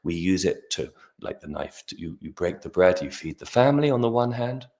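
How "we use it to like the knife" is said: ordinary talk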